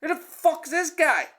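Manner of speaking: high, loud mock outrage at the stranger; mock indignant boyfriend. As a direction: squeal it like the offended new boyfriend. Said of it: "he's looking at me like".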